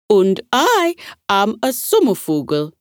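firm, proud declaration; strong and clear. Acted. Brave butterfly introduces himself to the meeting, strong and clear. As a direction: announce your name boldly. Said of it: "he said, strong and clear".

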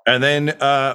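usual speaking voice

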